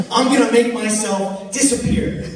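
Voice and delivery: high, loud showman announcement; mock magician. Drunk guy teasing the crowd, high loud magician boast. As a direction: announce the trick grandly.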